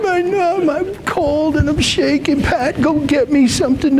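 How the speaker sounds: high whimpering sick-man whine; whiny man child. Retold or imitated. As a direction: whine like a big baby with a cold. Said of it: "How many of you whined like a little kid?".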